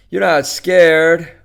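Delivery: loud, drawn-out taunt; pushy, high-pitched challenge; goading. Acted. Joe goads Edna on phone.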